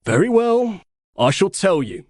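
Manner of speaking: high, puffed-up proud announcement; pompous pride. Acted. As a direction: announce it like a peacock. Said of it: "puffing out his chest".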